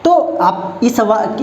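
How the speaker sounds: usual speaking voice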